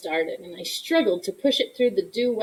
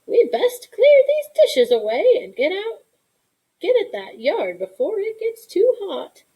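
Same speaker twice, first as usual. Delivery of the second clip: put-on much higher, louder old grandma; grandma's voice; 10 semitones higher, 4 dB louder than usual. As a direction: hurry the child along like a grandmother. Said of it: "Mama said".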